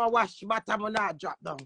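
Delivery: ordinary talk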